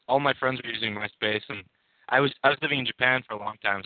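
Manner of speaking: ordinary talk, nothing marked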